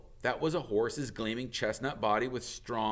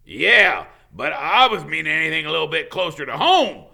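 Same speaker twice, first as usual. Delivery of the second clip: much louder, pushing impatience; impatient; 7 semitones higher, 14 dB louder than usual. Acted. Hagrid loses patience with Ronan, loud and insistent. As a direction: press him for a straight answer. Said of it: "watched him impatiently".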